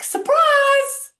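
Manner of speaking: high, loud cheerful "Surprise! Surprise!"; excited surprise. Shown as a demonstration. Greeting bad news as a happy surprise, loudly excited. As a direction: announce it like an unexpected party. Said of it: "another thing that I like to do".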